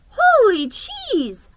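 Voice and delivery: high, slow squeak of alarm; squeaked in shock. A narrator's reading. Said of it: "I squeaked".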